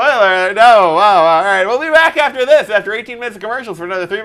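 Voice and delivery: loud, high host sign-off. Retold or imitated. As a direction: announce the break like a TV host. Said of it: "here's an episode of Talking Dead".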